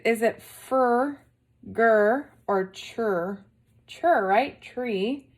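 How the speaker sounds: ordinary delivery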